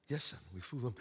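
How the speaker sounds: father hushing son, low and soft; low reassuring father. Acted. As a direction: reassure him quietly like a dad.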